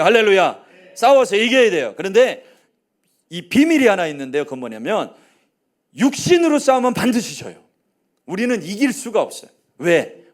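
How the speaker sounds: loud, rising sermon proclamation; full-force preaching. Acted. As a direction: preach it out to the whole church.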